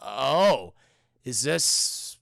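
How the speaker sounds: low, halting, careful asking; hesitant and low, word by word; awkward, tentative question. Retold or imitated. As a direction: edge into the question with long pauses. Leonard awkwardly asks if it's autobiographical.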